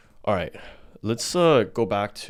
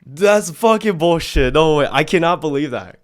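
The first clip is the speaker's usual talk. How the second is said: loud, high, incredulous outburst; outraged disbelief; 5 semitones higher, 7 dB louder than usual. Real, not acted. Host rejecting guest's entertainment-only excuse, loudly incredulous. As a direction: call it out with emphatic, raised-voice disbelief.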